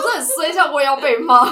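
loud, laughing self-pity; mock-woeful laugh; laughing lament. Real, not acted. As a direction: moan about bad luck while laughing. Laughing about being scolded.

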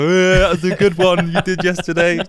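hammed-up hearty approval; belated mock amusement. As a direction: play at finally getting the joke. Acting out a late laugh at joke.

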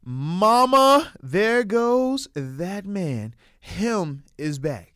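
loud drawn-out hype call; hype announcer. Acted. Hype host welcoming TJ Watt back, loud and slow. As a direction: stretch it out like a hype man. Hyping TJ Watt's return.

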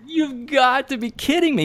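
high, laughing you've got to be kidding me; incredulous, laughing reply; laughing disbelief. Retold or imitated.

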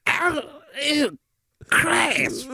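high, whiny squeal of disgust; Chris character voice. Acted. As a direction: squeak it out like a grossed-out weirdo. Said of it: "I don't know how you do that voice".

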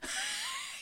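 high laugh. Real, not acted. Laughs at friend's joke.

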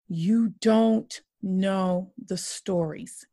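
each line slow, DON'T leaned on; slow emphatic refrain. Real, not acted. To those judging angry protesters, slow emphatic refrain. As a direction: hammer home what they don't know, slowly.

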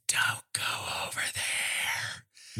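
slow, drawn-out mock stage whisper; loud stage whisper. Friend hiding behind a tree warns him off, exaggerated stage whisper. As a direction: whisper it hugely, every word stretched out. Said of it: "the loudest stage whisper ever".

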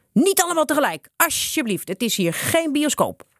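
high, heated call, slowing at the end; hot-tempered shout. A narrator's reading. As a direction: order the crowd back angrily. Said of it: "riep driftig". (driftig roepend)

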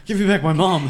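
high, loud, laughing demand; laughing plea. Real, not acted. Player jokingly demands the stone back through laughter. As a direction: laugh while begging for it back.

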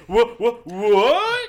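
loud rising baffled whats; confused what. Retold or imitated. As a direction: shout it in total confusion. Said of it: "then he's like".

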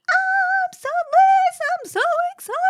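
very high squealing over-excited yelp, stretched out; high-pitched puppyish squeal of delight; demented spaniel puppy. Retold or imitated. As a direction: squeal like a yappy puppy thrilled to see a friend. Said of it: "Like some kind of demented spaniel puppy".

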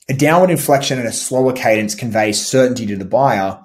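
usual speaking voice

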